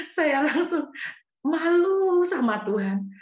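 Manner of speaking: high, crying cry of shame; tearful shame. Real, not acted. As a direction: choke out the shame, slowly. Ashamed confession, crying. (menangis malu dengan suara tinggi)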